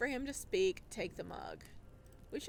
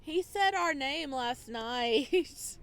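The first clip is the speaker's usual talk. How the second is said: loud, slow, thrilled outburst; can't believe he said our name; excited; 3 semitones higher, 6 dB louder, much slower than usual. Real, not acted. Gleeful reaction to the name.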